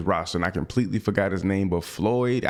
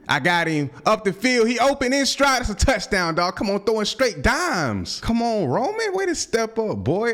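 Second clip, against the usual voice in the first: touchdown celebration; 13 semitones higher than usual. Real, not acted. Game-winning touchdown throw.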